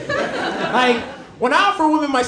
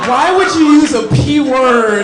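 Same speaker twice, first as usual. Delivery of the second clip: loud disbelief at the absurd spelling; exasperated shout; 7 dB louder, slower than usual. Real, not acted. Comic ranting to the crowd about his dad, loud and slow.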